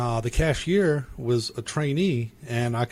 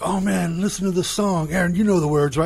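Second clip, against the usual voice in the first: higher, eager mock enthusiasm; excited friends; 7 semitones higher than usual. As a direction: hype up a song for a friend. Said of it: "they're like".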